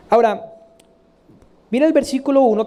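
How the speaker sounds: normal talking voice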